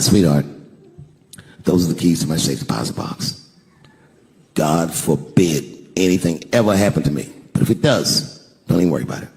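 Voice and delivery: low, solemn husband's comfort; tender reassurance. Retold or imitated. Husband giving his wife safe deposit keys, tender low reassurance.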